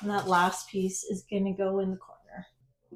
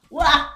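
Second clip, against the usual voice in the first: loud high wah of delight; amazed exclamation; 7 semitones higher, 10 dB louder than usual. Real, not acted. Thrilled cry at her wallpaper looking good. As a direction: burst out in happy amazement.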